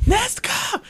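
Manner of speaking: very high-pitched mock cry; high call. Acting out the hero calling to his sister, high mock cry. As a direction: call a name out high, softly.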